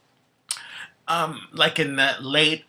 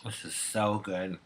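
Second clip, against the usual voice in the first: savoring delicious food; 2 semitones lower, 8 dB quieter than usual. Real, not acted. Eating and savoring crab.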